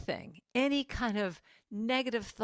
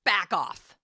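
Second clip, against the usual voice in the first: firm, louder back off; sharp, louder dismissal; attitude; 7 dB louder than usual. Shown as a demonstration. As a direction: push the critic away firmly. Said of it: "this attitude thing of going".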